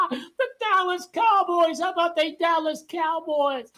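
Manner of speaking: laughing through the words; laughing mockery. Real, not acted. Streamer mocking the Dallas Cowboys, laughing hard.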